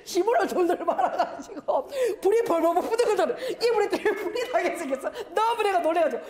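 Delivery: high, giggly storytelling; laughing retelling. Real, not acted. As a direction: tell it through your own laughter. Laughing as he retells the fire mishap.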